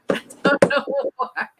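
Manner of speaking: helpless laughter breaking up the words; laughing. Real, not acted. Baffled by her own rhyming habit, laughing.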